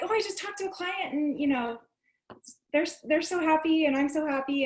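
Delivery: imitated giddy excitement; happy gushing. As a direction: gush it with a smile, retelling your own joy. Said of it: "just totally smiling".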